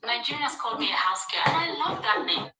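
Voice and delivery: ordinary talk, nothing marked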